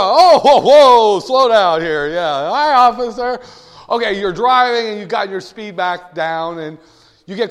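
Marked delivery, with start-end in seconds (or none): 0.1-2.1 loud, high whoa, easing off; mock alarm. Speeder seeing a cop car ahead, hammed-up alarmed braking. 2.1-3.4 raised, rising hello; mock innocent greeting. Guilty speeder to the officer, acted innocent greeting.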